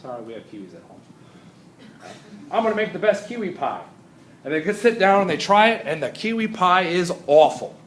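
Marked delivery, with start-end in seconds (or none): 2.5-3.8 imitated eager student. Eager student declaring a plan.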